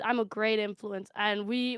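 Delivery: ordinary talk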